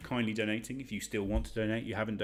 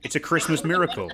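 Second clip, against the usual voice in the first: excited; 6 semitones higher, 7 dB louder than usual. Real, not acted. Charity donation surprise.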